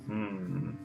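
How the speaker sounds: ordinary delivery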